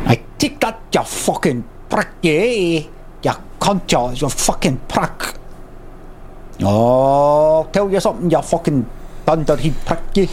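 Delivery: put-on overdone Scottish accent. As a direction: ham up the Scottish like an English actor in a film. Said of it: "Can you make it more Scottish, more Scottish than that".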